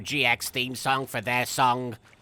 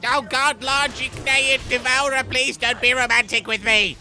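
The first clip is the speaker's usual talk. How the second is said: high, pleading mock panic; 6 semitones higher than usual. Begging the lord not to be romantic, high mock alarm. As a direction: beg him off in fake alarm. Panicking about being shipped.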